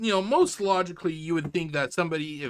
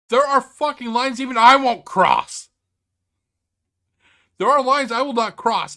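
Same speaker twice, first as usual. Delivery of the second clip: louder, hard-hitting declaration; angry emphatic rant; 6 semitones higher, 10 dB louder than usual. Real, not acted. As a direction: lay down the line, fuming. Angry rant about lines.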